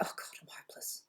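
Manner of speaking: a fully whispered inner critic line; despairing whisper. Retold or imitated. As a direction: breathe it out as a hopeless whisper. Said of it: "I'll just go".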